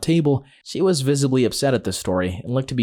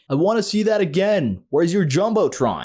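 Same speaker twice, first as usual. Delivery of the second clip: mock shout; 7 semitones higher than usual. A narrator's reading. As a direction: call it out like a heckle across an arena. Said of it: "and I shouted".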